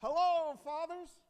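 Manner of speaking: big booming hello to the fathers; loud greeting. Shown as a demonstration. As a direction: shout the hello across the church. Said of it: "So should we say".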